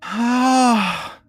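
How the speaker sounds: high, hammed-up sobbing girl; half-comic fake sobs, confused and scared. Crying girl to the party, mock sobbing. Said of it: "and she starts sobbing".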